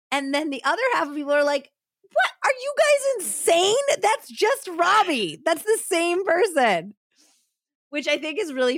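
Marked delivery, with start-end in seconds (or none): 2.0-6.9 high, loud mock outrage; outraged disbelief. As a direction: protest as if everyone's lost it. Acts disbelieving coworkers.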